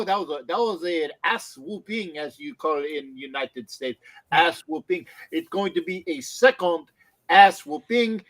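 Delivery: put-on French accent that slips in and out; mock French accent. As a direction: boast about the Lions in a mock French accent. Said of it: "My accent comes in and out".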